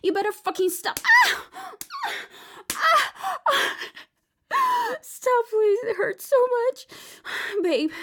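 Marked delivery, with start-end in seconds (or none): 1.0-1.4 sharp high scream of pain; pained cry. Acted. Woman crying out at her partner, sharp pained scream. 4.5-5.0 sharp high scream of pain; pained cry. Acted. Woman crying out at her partner, sharp pained scream.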